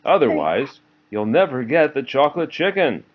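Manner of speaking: bossy sister. Acted. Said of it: "said Ruby".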